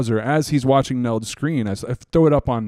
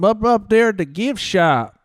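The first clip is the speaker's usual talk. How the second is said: slow, lightly put-on Southern voice; a hint of Southern accent; 6 semitones higher, slower than usual. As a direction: drawl it slowly like a prank caller. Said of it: "What was I saying with that voice one time?".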